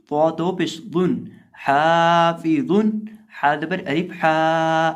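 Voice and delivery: हाफिज़ुन spelled slowly with a long हा; drawn-out हा in हाफी and हाफिज़ुन; lengthened Ha. Shown as a demonstration. Teacher drills pupils, slow spelled recitation. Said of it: "हा को लंबा करके पढ़ेंगे".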